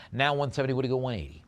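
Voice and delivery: fast, punchy auctioneer patter; auction bid calling. Acted. Auctioneer to online bidders, brisk bid calling. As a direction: rattle off the bids and knock it down.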